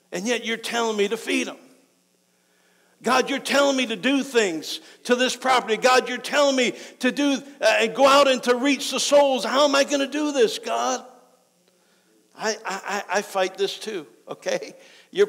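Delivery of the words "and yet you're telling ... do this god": high, mounting plea to God; acted-out cry of being asked too much; exasperated protest. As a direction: build into a loud, overwhelmed complaint.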